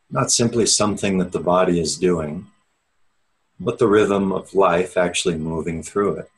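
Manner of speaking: measured, unhurried meditation guide with long pauses; steady, spacious meditation-teacher delivery; guided meditation. Real, not acted. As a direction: ease listeners inward, leaving space after each phrase. Leads an opening meditation.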